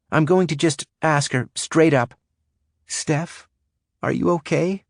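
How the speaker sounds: slightly shaky, high voice. Acted. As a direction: let the voice shake a little. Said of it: "Lucy's voice shook a little".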